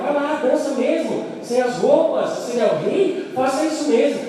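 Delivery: zombaria mais aguda e arrastada; Mical zombando. Retold or imitated. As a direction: provocar o rei com desdém. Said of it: "ela falou". (Mical zombando de Davi, tom agudo de desdém)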